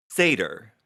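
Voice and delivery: SAY-ter, questioning the word Satyr; pronunciation check. Shown as a demonstration. Player checks the game master's word, asking how to say it. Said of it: "And this is out of game".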